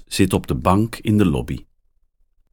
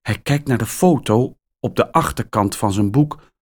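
ordinary delivery

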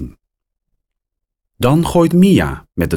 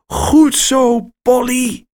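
much higher and louder praise; happy call; 4 dB louder, slower than usual. A narrator's reading. Max praising Polly for another six, high excited call. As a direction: cheer the parrot on. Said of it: "roept hij".

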